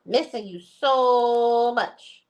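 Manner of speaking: high, loud, long-drawn longing; pining girlfriend. Acted. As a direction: stretch SO right out, hammed-up. Acting out Sonia's love text.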